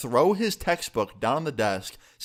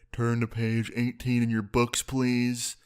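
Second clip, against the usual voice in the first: toneless, monotone miserable teacher; Critical. Acted. Miserable teacher droning to his class, Critical-style monotone. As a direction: drone it out in a dead-even monotone. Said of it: "I kinda sound like Critical".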